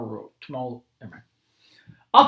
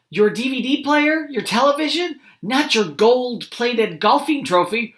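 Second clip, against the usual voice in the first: Mom questioning; 9 semitones higher, 4 dB louder than usual. Acted.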